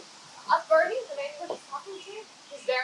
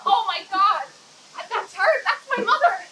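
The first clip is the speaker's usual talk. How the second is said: loud, high gasp of recognition; shocked delight; 6 semitones higher, 11 dB louder than usual. Real, not acted. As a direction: cry out as you spot her face. Recognizing her mother's photo.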